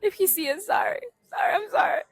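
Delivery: high-pitched, sweetly pleading sorry; playful apology. Pretend. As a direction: plead forgiveness in a little high voice. Apology addressed to the neighbor.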